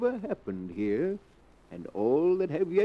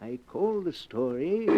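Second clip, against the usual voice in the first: usual speaking voice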